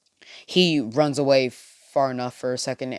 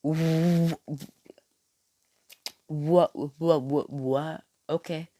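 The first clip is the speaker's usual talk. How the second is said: confused stammer; 4 semitones higher, slower than usual. Retold or imitated. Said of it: "walks off in confusement".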